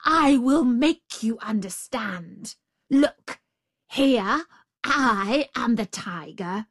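exasperated tiger spelling it out; enraged tiger. A narrator's reading. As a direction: hammer each word in acted rage. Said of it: "in a rage".

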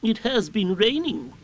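high, slow owl voice; Owl explaining. A narrator's reading. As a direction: explain it slowly as a pompous owl. Owl explains in plain words.

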